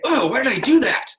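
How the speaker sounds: high-pitched, groaning lament; exasperated self-reproach. Shown as a demonstration. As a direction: rhetorical wail, closed to any answer. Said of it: "even the way I say that".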